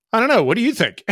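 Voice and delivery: high, loud clueless I don't know; shrugging reply. Retold or imitated. Backseater shrugging back at the pilot, high and unsure. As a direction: toss the question back, unsure.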